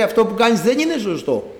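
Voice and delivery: lightly acted moral scolding; nagging conscience. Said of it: "ενοχλητική αυτή η φωνή της συνείδησης".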